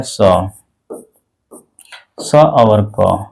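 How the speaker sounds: slow s then k sounds; letter sounds s and k. Shown as a demonstration. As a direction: say स as a short s and क as a short k, slowly. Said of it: "सी को आपको बोलना है".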